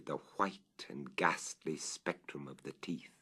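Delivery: a hushed, horrified whisper; haunted whisper. Acted. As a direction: breathe it out soft and ghostly. Obsessed narrator recalls teeth.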